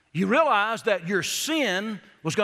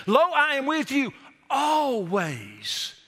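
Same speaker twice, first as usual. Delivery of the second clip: slow, reverent reading of the promise; very slow and reverent, proclaiming Christ's promise; solemn scripture reading; 4 semitones higher, much slower than usual. Acted. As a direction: dwell on each word like a sacred vow. Verse read as Jesus' promise.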